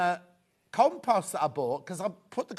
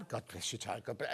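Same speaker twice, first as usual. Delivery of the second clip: imitating the priest; 8 semitones lower, 12 dB quieter than usual. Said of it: "And he's like".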